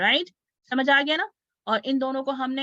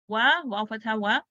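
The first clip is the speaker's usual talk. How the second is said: ordinary talk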